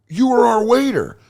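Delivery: high-pitched mock bro voice; put-on high, accusing voice; incredulous customer. Guy recognises his waiter, acted.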